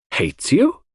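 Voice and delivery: high, incredulous question; astonished. A narrator's reading. Hastings astonished that Mary hates Cynthia, crying out. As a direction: echo it in astonishment, pitch jumping up. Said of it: "I cried, astonished".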